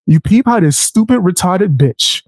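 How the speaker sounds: ordinary talk, nothing marked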